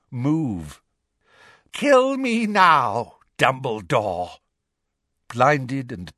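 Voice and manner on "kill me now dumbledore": Voldemort voice. Acted. Voldemort speaking through possessed Harry.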